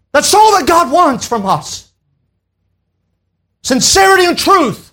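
high, loud, impassioned sermon delivery; full-force preaching. Acted. Preacher challenging believers why they would live in sin, full-force preaching. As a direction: preach it hard, stretching the key words.

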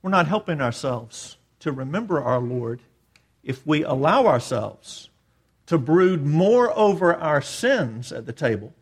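ordinary talk

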